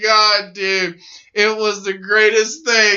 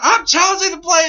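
higher, quick indignant protest; mock indignant; 8 semitones higher, faster than usual. Wrestler telling the referee he challenges the play, mock indignant.

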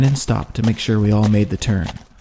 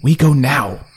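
firm, louder order; commanding; 4 semitones higher, 5 dB louder than usual. A narrator's reading. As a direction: give the order, no argument. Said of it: "he commanded".

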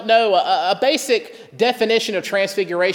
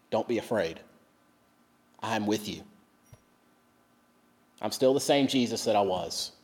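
much softer, low and gentle comfort; reassuring; 9 semitones lower, 8 dB quieter than usual. Retold or imitated. Jesus comforting his terrified disciples, softly reassuring. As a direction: soothe frightened friends back to their feet. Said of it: "he assures them".

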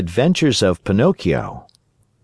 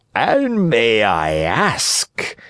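very slow, oily fox voice; sly, leisurely fox; inquiring fox; 2 semitones higher, much slower than usual. A narrator's reading. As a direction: draw the polite question right out. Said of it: "inquired the fox".

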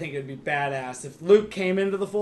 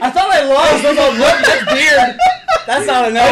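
laughing; 7 semitones higher, 10 dB louder than usual. Real, not acted. Laughing story.

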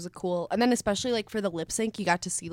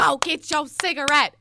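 mock shout; 8 semitones higher, 9 dB louder than usual.

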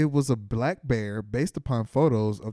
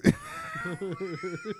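high shrieking laugh; 5 dB quieter than usual. Real, not acted. Laughing at own misreading.

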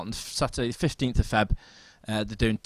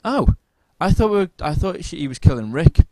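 high, loud "Oh", then explaining; retold surprised realisation; 6 semitones higher, 9 dB louder than usual. Retelling partner's mix-up about Rick, high-pitched surprise. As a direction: imitate someone suddenly confused, pitched up.

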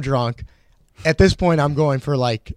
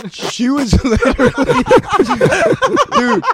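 laughing; 8 dB louder than usual. Real, not acted. Laughing mid-story.

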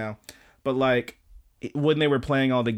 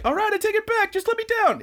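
very high, squeaky plea; mock terrified plea. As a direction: beg in a squeaky panic. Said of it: "he's all pissed off".

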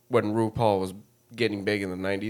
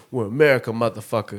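loud American bravado; 5 dB louder, faster than usual. Pretend. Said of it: "the bullets from the U S A say?".

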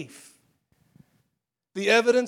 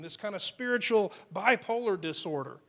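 usual speaking voice

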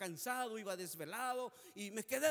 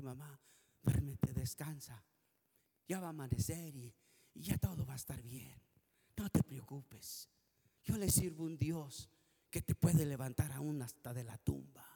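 much lower, calm and reassuring; soothing words to mother; 7 semitones lower than usual. Retold or imitated. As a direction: settle her gently to sleep. Son soothing his revived mother.

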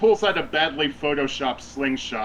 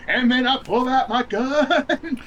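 high, laughing through the line; 11 semitones higher, 4 dB louder than usual. Acted. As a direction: giggle through it. Laughing while voicing Kaya.

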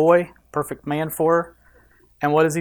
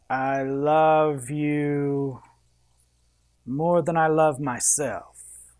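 very slow, drawn-out lover's croon; romantic accent; 3 dB quieter, much slower than usual. Acted. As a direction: melt every word like a movie suitor. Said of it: "you gotta talk in a real good accent".

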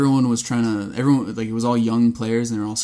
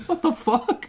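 stunned hockey player; much slower than usual. Retold or imitated. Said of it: "The guys are just like".

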